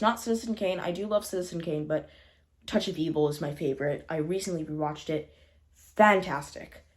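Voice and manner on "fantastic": slow, louder, savoured word; drawn-out praise. Real, not acted. Reviewer gushing over a favorite noir, slow and loud. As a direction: stretch it out, relishing it.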